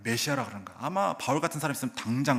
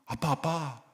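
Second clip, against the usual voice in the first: put-on child's voice; 2 semitones higher, slower than usual. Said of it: "제 아들이 가다가".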